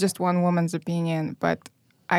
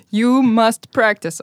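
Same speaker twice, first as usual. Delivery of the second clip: father's order; 3 semitones higher, 6 dB louder, slower than usual. Retold or imitated. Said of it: "retelling father's order".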